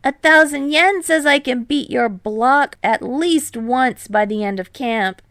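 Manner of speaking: high, loud eager bet; loud challenge. A narrator's reading. Hinata betting Kei he can beat his block, loud. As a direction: throw down the challenge.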